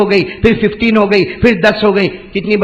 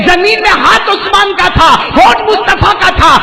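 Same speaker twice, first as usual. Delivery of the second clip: high, loud proclamation to the crowd; full-force preaching; 13 semitones higher, 6 dB louder than usual. Real, not acted. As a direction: ring it out, pitched high and loud.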